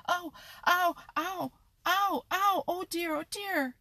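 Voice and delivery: high, loud yelps of pain; young fox yelping on the wire barb. A narrator's reading. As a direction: howl as the barb bites the paw. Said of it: "That was the voice of Reddy Fox".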